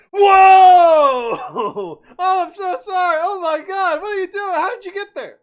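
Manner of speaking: hammed-up shrieking neighbor in disbelief; shocked neighbor shout. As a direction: shriek it like the neighbor who just learned you're abroad. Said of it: "she's like".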